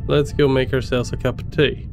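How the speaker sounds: usual speaking voice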